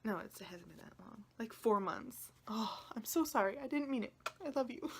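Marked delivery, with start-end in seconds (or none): 2.5-4.9 high, plaintive apology; affectionate sorry, voice lifting high; pleading apology. Real, not acted. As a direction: plead for forgiveness like to a loved one. Apologizing lovingly to her deck.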